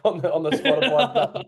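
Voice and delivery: laughing through the words. Real, not acted. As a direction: chuckle as you say it. Apologising for noise, laughing.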